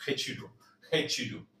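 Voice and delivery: rechiru with a throaty R; Portuguese retiro. Shown as a demonstration. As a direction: repeat rechiru for practice. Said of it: "Practíquelo".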